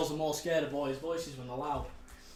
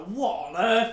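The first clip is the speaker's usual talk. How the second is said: retelling her friend's shocked reaction, higher and louder; shocked gasp; 6 semitones higher, 8 dB louder, slower than usual. Retold or imitated. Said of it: "And she was like".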